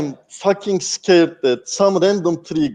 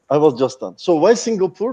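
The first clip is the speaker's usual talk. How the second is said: normal talking voice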